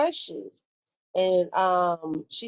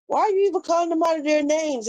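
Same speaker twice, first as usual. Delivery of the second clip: higher and faster, exasperated; exasperated repeat; 9 semitones higher, 3 dB louder, faster than usual. Real, not acted. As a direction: fire the question off in disbelief. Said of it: "repeats the question more forcefully".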